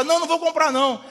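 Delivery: lightly acted brisk refusal; parent's refusal. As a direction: act out a parent firmly saying no. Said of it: "E você fala".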